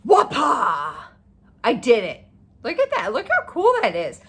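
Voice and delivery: loud, thrilled whoop; excited cheer. Real, not acted. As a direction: cheer it out proudly. Celebrating reaching her goal.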